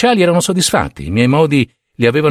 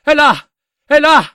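frenzied confession; 12 semitones higher than usual. A narrator's reading. Killer confessing to the officers, frenzied shout. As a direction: scream the confession, tear it out of yourself.

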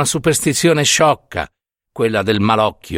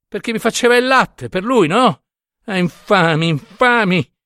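higher-pitched bitter lament; aggrieved outburst; 6 semitones higher than usual. A narrator's reading. Wronged mother telling the curate of the evil eye, bitter. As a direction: build from hurt to indignant accusation. Tearful mother accuses those who cursed her.

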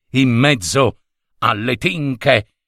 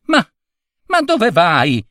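shouting; 6 semitones higher than usual. Acted. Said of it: "gridò il signore".

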